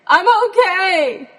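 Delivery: high, loud call from far off; shouting back. Retold or imitated. Stuck Jen answering her searching dad, shouting high and loud. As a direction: yell so he can hear you.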